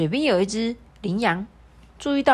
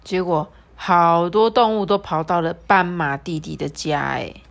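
ordinary delivery

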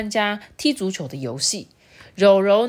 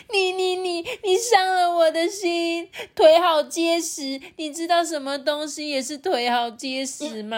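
crying hippo; 6 semitones higher than usual. Acted. Rourou takes a remark as an insult, wailing in hurt. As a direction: wail it out like a hurt crybaby. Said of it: "一直哭".

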